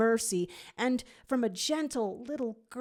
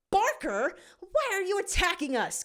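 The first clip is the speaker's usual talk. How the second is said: mock growl; 11 semitones higher than usual. A narrator's reading. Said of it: "Gadget growls".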